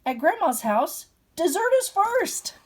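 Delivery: high, loud excited shout. Acted. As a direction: call out with playful alarm. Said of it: "Grandma shouted".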